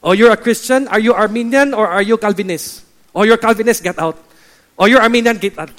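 loud, high challenge, dropping on each dismissal; hammed-up zealot throwing people out; mock interrogation. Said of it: "They will say to you".

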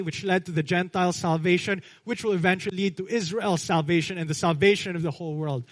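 normal talking voice

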